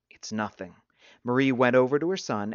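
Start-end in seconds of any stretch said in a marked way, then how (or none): 0.1-0.7 low, subdued brush-off. A narrator's reading. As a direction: drop it low and soft, cutting her off. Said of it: "Christopher's low voice cut her off".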